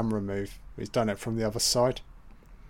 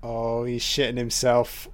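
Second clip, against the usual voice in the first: ordinary talk, nothing marked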